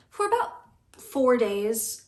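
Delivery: ordinary talk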